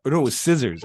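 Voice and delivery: ordinary talk, nothing marked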